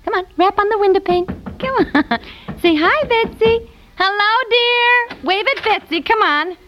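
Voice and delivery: high-pitched, playful coaxing; excited baby talk. Acted. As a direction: coax a baby to wave hello, sing-song. Coaxing baby to greet sister.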